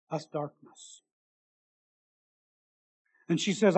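ordinary delivery

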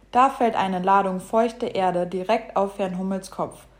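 usual speaking voice

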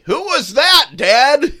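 put-on high, loud child calling to Dad; really loud kid. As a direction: blurt it out for everyone to hear. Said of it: "my kids would say really loudly".

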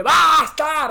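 imitated loud "Basta!"; mock shout. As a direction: replay the shout at him. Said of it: "te acordás que dije". (imitando el grito "¡Basta!")

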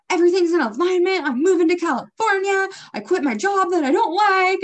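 high, loud, breezy overconfidence; smug past self. Retold or imitated. Mocking her past self to a friend, loud and breezy. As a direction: sing out your big plans like nothing can go wrong.

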